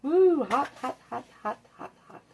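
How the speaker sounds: quick pained hot hot hot, fading away; gasping at hot food in hand; burning fingers. Real, not acted. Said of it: "This is hot".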